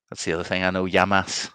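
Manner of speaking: normal talking voice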